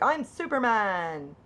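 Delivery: high, drawn-out mock boast; imitated playground hero cry; child playing superhero. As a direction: proclaim it slowly like a kid at play. Acting out a child's pretend play.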